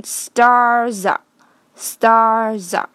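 "stars" drawn out with a buzzing z end; long vowel, voiced z in "stars"; linked ending. Shown as a demonstration. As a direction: say "stars" slowly as star-za, linking into "are". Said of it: "这里的S是发Z的音".

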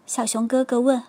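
plain reading voice

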